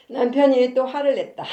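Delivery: 웃음 섞어 크게 읽는 첫 문장; 웃음을 참지 못하고 읽어 내려가기; 웃으며 읽기. Real, not acted. Reading own story aloud. (웃음 섞인 낭독)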